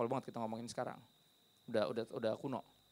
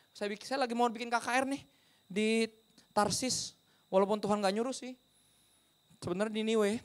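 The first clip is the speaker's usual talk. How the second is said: higher-pitched pastor casually explaining himself; mock self-important pastor; 8 semitones higher than usual. Preacher plays sleeping evangelist answering sailors, higher-pitched. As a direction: play a pastor breezily introducing his work. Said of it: "Apa kerjaan?".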